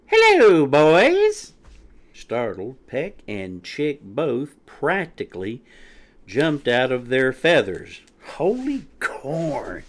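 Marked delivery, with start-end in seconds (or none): 0.1-1.5 loud, very high chirpy greeting; high peep. A narrator's reading. Quinny popping out at the boys, high loud peep. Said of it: "hopped out of her hiding place and peeped". 8.4-9.8 slow, higher gasped exclamation; startled gasp. A narrator's reading. Peck startled out of his feathers, gasping. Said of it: "gasped Peck".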